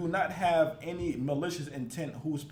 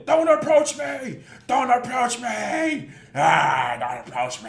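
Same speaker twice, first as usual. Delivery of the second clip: mock yelling; 6 semitones higher, 8 dB louder, slower than usual. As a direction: yell it like an overreacting woman in mockery. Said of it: "to yell at this man".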